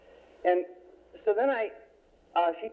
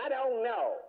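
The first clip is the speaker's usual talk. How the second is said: high-pitched, imitated stonewalling; petulant denial; 10 semitones higher, slower than usual. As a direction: imitate a girl refusing to play along. Girl stonewalls the therapist.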